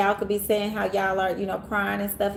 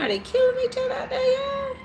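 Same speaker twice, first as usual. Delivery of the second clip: alarmed. Real, not acted. Sirens outside, alarmed.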